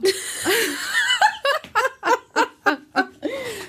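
long high-pitched giggle; high laugh. Real, not acted. Laughing at the joke.

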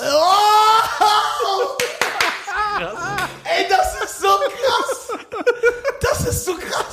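very high, laughing shriek of delight; real fan thrill. Real, not acted. Fan hears his hero's dubbed voice live, thrilled shrieking laughter. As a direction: squeal the words in amazement, drawn out.

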